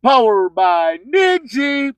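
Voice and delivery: slow low processed radio ID; station tag. Acted. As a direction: roll out the station name like a jingle.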